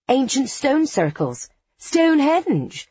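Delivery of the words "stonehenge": quick, eager Stonehenge, slowly stressed; alert chime. A narrator's reading. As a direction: chime in like a quiz know-it-all. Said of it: "chimes in Demeter alertly".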